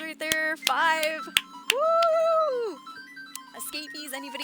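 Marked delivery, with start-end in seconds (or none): 1.7-2.8 high, loud woo; excited cheer. Real, not acted. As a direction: whoop for the gifted subs. Cheering for gifted subs.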